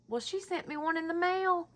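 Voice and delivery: ordinary talk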